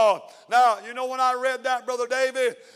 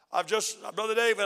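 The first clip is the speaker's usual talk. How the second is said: normal talking voice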